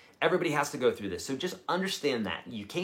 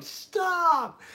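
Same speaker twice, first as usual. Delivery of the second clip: put-on kid yelling stop; 3 dB louder than usual. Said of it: "to where he's like".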